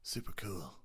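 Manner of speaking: breathy whispered put-on voice; a lightly done silly whisper voice. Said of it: "I just like doing that voice".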